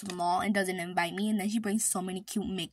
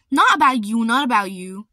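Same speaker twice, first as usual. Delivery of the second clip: much louder protest; loud repeated denial; 15 dB louder than usual. Real, not acted. Denying to her sister, loud insistent yell. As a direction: yell it to cover yourself.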